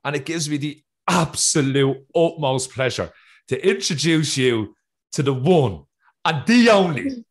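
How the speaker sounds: building to a loud, high shout of the name; hyped announcer intro. Real, not acted. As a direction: announce the guest like a boxing MC. Host hyping guest introduction.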